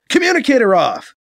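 loud curt order; commanding. Acted. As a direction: bark at the device. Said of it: "B C commands".

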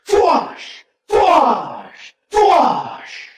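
volley of high, whooshing laser shots; rifle blast sounds. A narrator's reading. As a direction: mouth separate energy-gun blasts. Narrator voices rifle blast sound effects.

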